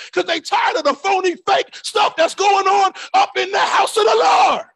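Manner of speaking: shouted, rising sermon delivery; fiery preaching. Real, not acted. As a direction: preach it hard to the church. Preaching at full force.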